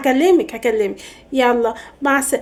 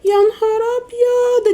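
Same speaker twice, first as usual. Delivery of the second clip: high, drawn-out cry of dread; dismayed wail; 7 semitones higher, slower than usual. Acted. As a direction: stretch it out high in comic alarm. Dreaded phone call arrives. (ولولة عالية ممطوطة من الخضة)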